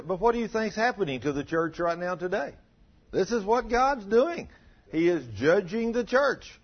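impassioned pulpit warning; rising sermon warning. Acted. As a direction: warn the church with alarm. Preaching at full force.

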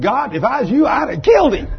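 loud, high jump to a shout; mock outburst. Preacher tells God his own harsher verdict, mock outburst. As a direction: blurt it loudly, climbing on the end.

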